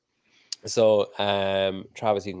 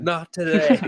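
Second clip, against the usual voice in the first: higher, louder acted outburst with laughter; mock defiant refusal; 8 semitones higher, 6 dB louder, slower than usual. Host imagines bargaining while diving into the cold tub, mock defiant. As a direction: laugh through a raised-voice mock protest.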